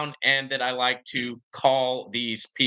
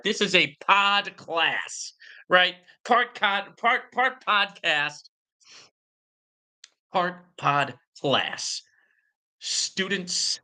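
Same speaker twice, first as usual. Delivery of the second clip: slow emphasis; 4 semitones higher, much slower than usual. Real, not acted. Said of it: "I like to bring you the edutainment".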